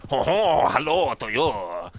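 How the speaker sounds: high, loud, slow cartoon French accent; a hammed-up French greeting; mock French accent. Shown as a demonstration. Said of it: "If you went to France".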